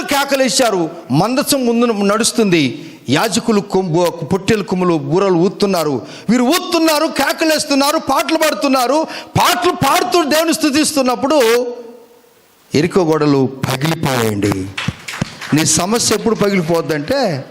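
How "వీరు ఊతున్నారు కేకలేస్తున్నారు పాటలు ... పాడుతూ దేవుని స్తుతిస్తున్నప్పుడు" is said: high, rising fervent build; preaching. Acted. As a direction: drive the praise scene upward. Preacher building the victory scene.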